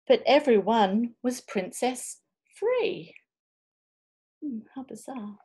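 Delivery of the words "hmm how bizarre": soft unvoiced whisper; whispered reader aside; hushed aside. Real, not acted. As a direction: murmur it under your breath.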